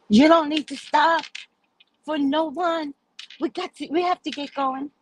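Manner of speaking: high-pitched imitation of a complaining woman; mock complaining. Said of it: "She starts to complain".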